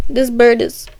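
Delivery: plain reading voice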